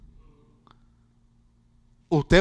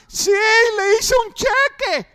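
sly widow voice; 13 semitones higher, 5 dB louder, slower than usual. Retold or imitated. Widow's punchline reply.

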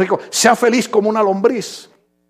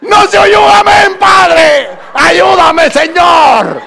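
loud, high wail up to heaven; mock desperate plea; 9 semitones higher, 6 dB louder, much slower than usual. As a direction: cry out for help in comic despair.

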